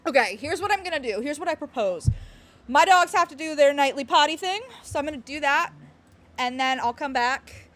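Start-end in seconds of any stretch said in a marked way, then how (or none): none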